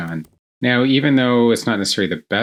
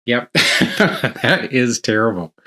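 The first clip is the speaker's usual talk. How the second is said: laughing; 3 semitones higher, much slower than usual. Real, not acted. Laughs at bad example.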